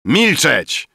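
high, loud order for silence; shouted command. A narrator's reading. Drunken Rafael to the cursing guests, shouted command. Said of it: "wykrzyknął Rafael".

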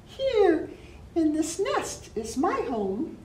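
high chirpy bird voice; robin voice. Acted. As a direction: speak like a bright little robin. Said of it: "sang the robin".

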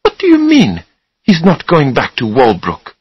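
very high start, then dropping; astonished. A narrator's reading. Susanna astonished at Charlie's refusal, incredulous. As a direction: question him in disbelief. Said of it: "Susanna was astonished".

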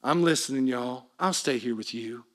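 low, soft surrender; acted quiet concession to loved ones; giving in. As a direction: yield softly, voice dropped low. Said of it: "so tempting for Paul to turn around and say".

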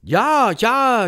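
high, loud cheering; jubilant cheer. A narrator's reading. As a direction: cheer like an adoring crowd. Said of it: "jubelte die Versammlung". (lauter, hoher Jubel)

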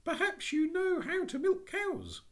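put-on high, bright child's voice; a high little-girl voice. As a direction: call out like an eager little girl.

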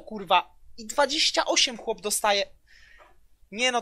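usual speaking voice

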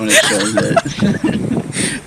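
high-pitched, laughing through the words. Real, not acted. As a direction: squeal it out while giggling. Cracking up at the joke.

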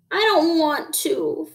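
put-on high, slow childish whine; whining. A narrator's reading. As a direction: drag it out in reluctant protest. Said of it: "Froggy whined".

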